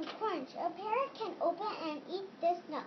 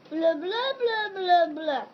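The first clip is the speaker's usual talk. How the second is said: loud fish babble; 8 dB louder than usual. Acted.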